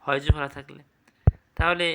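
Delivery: ordinary talk, nothing marked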